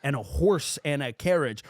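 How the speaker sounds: normal talking voice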